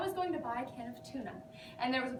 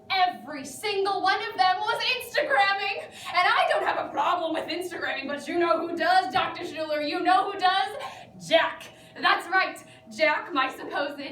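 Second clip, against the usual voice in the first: loud, high, outraged venting; indignant rant; 4 semitones higher, 9 dB louder than usual. Acted. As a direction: rant furiously to the therapist. Acted rant about Instagram and boyfriend.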